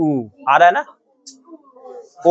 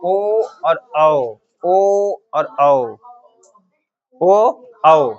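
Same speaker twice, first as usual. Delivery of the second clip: slow vowel demo; much slower than usual. Shown as a demonstration. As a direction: draw out ओ gently then औ with force. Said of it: "ओ को धीरे बोलते हैं". (धीरे-धीरे ओ और औ)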